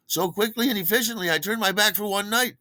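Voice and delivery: high-pitched, indignant disbelief; comic exasperation. Real, not acted. Comic exasperation at messed-up cellar.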